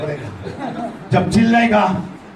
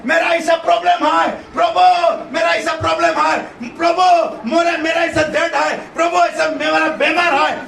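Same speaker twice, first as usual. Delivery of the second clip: pleading cry to God; 7 semitones higher than usual. Retold or imitated. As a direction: cry out to the Lord for healing. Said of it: "क्या करें बोल के पुकारता है".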